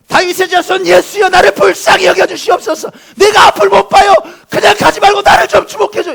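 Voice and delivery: very high, loud pleading shout; full-force cry for mercy; Bartimaeus's desperate scream. Acted. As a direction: scream to be heard over the crowd. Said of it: "이렇게 소리를 지른 거예요".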